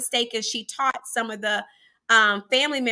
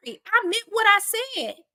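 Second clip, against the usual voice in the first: put-on childhood defiance; 9 semitones higher than usual. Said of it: "I'm like".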